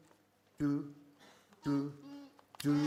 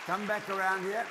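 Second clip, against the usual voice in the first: calling out; 5 semitones higher, 5 dB louder than usual. Real, not acted. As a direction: call them back loudly. Calling children back over.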